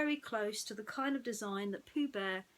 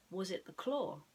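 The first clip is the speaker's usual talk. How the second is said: ordinary delivery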